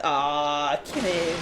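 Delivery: low, resigned giving in; weary low sigh. Retold or imitated. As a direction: give in with a tired low sigh. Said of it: "you're like".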